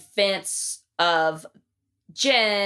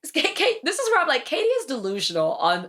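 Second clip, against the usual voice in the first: exasperated; 7 semitones higher than usual. Real, not acted. Exasperated outburst about Katie.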